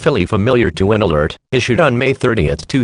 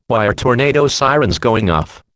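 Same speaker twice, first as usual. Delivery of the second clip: ordinary delivery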